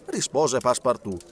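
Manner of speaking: plain reading voice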